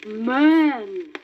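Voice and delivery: a grave, loud alarm; dreadful warning. A narrator's reading. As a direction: warn the herd of danger. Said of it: "uttered one dreadful word".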